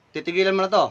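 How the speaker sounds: ordinary talk, nothing marked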